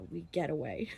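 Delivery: ordinary delivery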